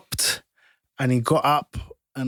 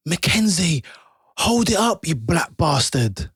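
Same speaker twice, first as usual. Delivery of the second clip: imitated yell across a football pitch; retold loud shout; 7 semitones higher, 3 dB louder, slower than usual. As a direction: call out high and loud, re-enacting an insult.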